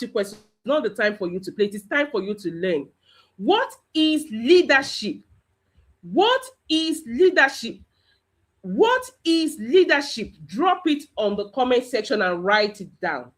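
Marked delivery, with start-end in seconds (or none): 3.4-10.3 "What is leadership?" pitched high, each word set apart; deliberate, raised-pitch "What is leadership?"; emphatic teacher question. Shown as a demonstration. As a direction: put the question slowly to a class, higher voice. Teacher drills the class with a question.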